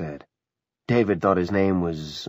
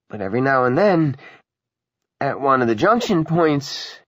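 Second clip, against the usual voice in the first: raised, earnest explaining voice; apologetic little conductor; 6 semitones higher, 5 dB louder than usual. Acted. Ratner explaining the derailment to the passengers, apologetic. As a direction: explain haltingly, pitched above your own voice.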